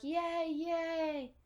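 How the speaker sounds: high, lightly acted cheering; mock crowd cheer. Everyone celebrates Thomas, imitated cheering. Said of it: "they all start shouting".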